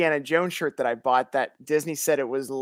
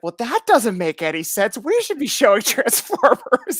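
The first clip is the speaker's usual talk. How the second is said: mock the clerk's indignation; hammed-up outraged cashier; imitating clerk; 7 semitones higher, 7 dB louder than usual. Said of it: "she's like".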